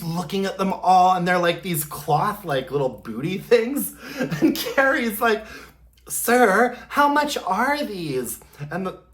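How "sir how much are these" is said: high, drawn-out haughty customer; mimicked Carrie. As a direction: ask it in a slow, put-on high voice. Acts Carrie at the market.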